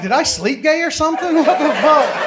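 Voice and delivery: high, loud, laughing disbelief; patient shouting at the nurse in laughing confusion; baffled outcry. Retold or imitated.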